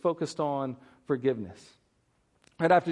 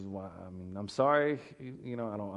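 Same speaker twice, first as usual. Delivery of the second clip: a soft, hesitant mumble of an embarrassed debtor; sheepish, much softer plea to the king; timid debtor; 6 semitones lower, 6 dB quieter than usual. Retold or imitated. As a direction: shrink away, caught without the money. Servant pleads he can't pay.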